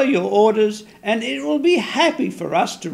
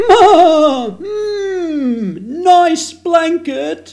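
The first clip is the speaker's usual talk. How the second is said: bleating goat; 5 semitones higher, 5 dB louder, much slower than usual. Acted. Goat bleats again, laughing.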